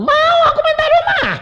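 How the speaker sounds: hammed-up high, loud whine; whining. Begging God for a house, high loud whining. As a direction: whine like a nagging child, very high. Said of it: "pokoknya ngerengek terus aku bilang".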